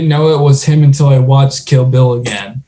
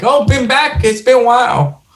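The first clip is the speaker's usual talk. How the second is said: high, chirpy welcome; mock waitress greeting; 9 semitones higher than usual. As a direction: lightly greet a regular. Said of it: "they were like".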